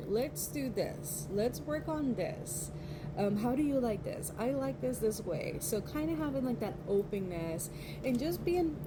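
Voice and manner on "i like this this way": normal talking voice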